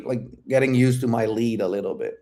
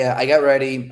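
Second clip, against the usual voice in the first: usual speaking voice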